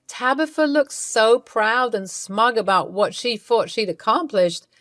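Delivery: ordinary delivery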